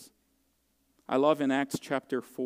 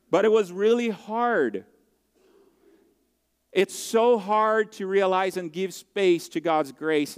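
heartfelt; 5 semitones higher, 4 dB louder than usual. Real, not acted. Emotional peak of testimony.

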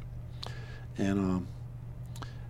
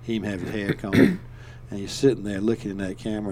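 ordinary delivery

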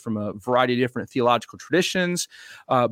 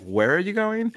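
confused American; 8 semitones higher than usual. Retold or imitated. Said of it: "we're like".

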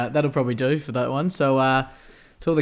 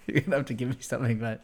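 ordinary talk, nothing marked

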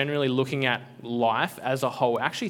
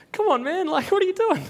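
much higher, louder disbelieving plea; exasperated; 5 dB louder than usual. Real, not acted. Preacher scolding people who doubt God, exasperated and high. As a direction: throw up your hands in mock exasperation.

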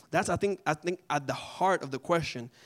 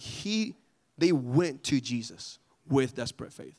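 normal talking voice

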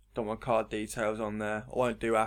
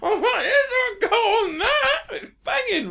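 high, loud, giddy retelling; hammed-up enthusiasm; 8 dB louder than usual. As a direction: squeal it like an overexcited mate. Acts out excited fidget cube exchange.

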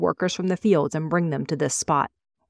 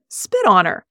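"Spit on her" louder and sharper, stressing Spit; firm repeated order; 4 semitones higher, 6 dB louder than usual. A narrator's reading. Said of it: "You heard me".

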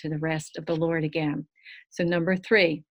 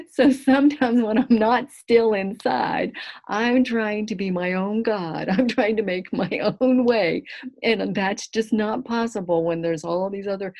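higher and louder, laughter still in the voice; laughing while talking; 4 semitones higher, 6 dB louder than usual. Real, not acted. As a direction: talk through the giggles. Talking through lingering laughter.

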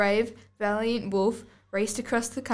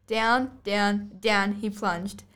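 dramatic slow fall; much slower than usual. Acted. Narrator dramatizes the wolf's fall, slow. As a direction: let each down sink.

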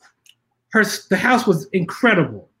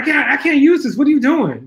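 high, louder mock exasperation; exasperated boss; 7 semitones higher, 3 dB louder than usual. Boss scolding the assistant over the spreadsheet, exasperated. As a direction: throw up your hands at the mess. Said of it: "And it was like".

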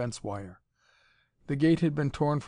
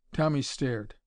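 usual speaking voice

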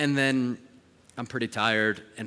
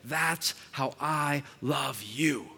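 slow, gentle divine words; tender voice of God; 3 semitones higher, much slower than usual. Retold or imitated. God speaking to the father about his daughter, tender and slow. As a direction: let it land slowly, like God speaking. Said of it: "heard this voice that said".

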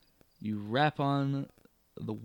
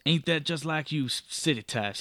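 a touch of spat contempt; mild spitting scorn. Acted. Coach driver sneering at the city traveller, mild scorn. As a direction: sneer it at the city folk, lightly. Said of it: "he spits".

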